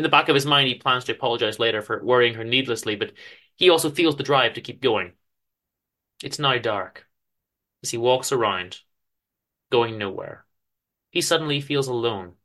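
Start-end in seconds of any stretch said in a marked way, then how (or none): none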